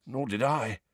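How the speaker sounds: rough, hoarse and subdued. A narrator's reading. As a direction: say it with a catch of hoarseness. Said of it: "said Ron rather hoarsely".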